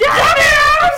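high, hammed-up desperate yell; mock-frantic shriek; escape shout. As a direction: scream it, half laughing. Baby trying to escape, acted.

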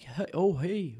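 light British Columbia accent on a greeting; slightly put-on BC accent. Shown as a demonstration. Guest shows how BC people talk, light BC accent. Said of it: "We talk more like".

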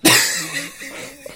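high, wheezy last-breath hack; dying wheeze. Real, not acted. Podcaster running out of breath on mic, dying wheeze. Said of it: "sounded like I was dying".

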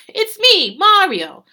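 high, loud cartoon Italian accent; Mario. Mocking Luigi the handyman with a loud cartoon Italian accent. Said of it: "That's what he sounds like".

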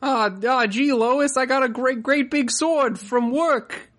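high, slow cartoon dad voice, drawn out; goofy Peter Griffin bragging to Lois. Cartoon dad bragging to his wife about a sword. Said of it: "That's Peter".

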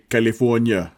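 put-on lightly done Austrian-accented catchphrase; Arnold Schwarzenegger accent. Teasing co-host about his game, mock Schwarzenegger accent. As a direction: say it with an Austrian action-star accent. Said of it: "Arnold Schwarzenegger".

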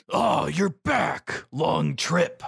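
slow, high-pitched acted welcome; greeting character. Voicing a character greeting Nami.

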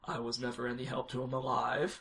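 hushed, understated dramatic quote; quietly dramatic. Retold or imitated. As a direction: deliver it softly, as a grave confession. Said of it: "said something that's super dramatic".

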